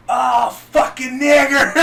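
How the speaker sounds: slow, loud, high, laughing yell; pain shout. Retold or imitated. Woman getting the tattoo, loud pained shout. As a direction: howl it as the needle hits. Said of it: "she's like".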